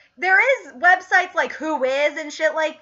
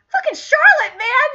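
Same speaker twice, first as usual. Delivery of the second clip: high, loud lament; exasperated exclamation; 12 semitones higher, 7 dB louder than usual. Real, not acted. Fan blaming Charlotte for destroying Butters, exasperated. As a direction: cry out her name in exasperation.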